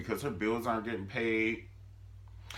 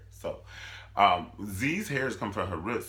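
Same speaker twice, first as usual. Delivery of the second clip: ordinary talk, nothing marked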